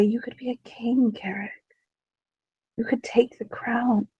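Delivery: soft, breathy temptation close to his ear; seductive whisper. Acted. Demonia presses against Carrig, tempting him with a whisper.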